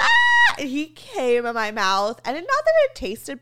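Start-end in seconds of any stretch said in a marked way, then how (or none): 0.0-0.5 a sudden high shriek. Real, not acted. Reacts to the story.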